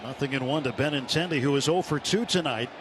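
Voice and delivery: ordinary talk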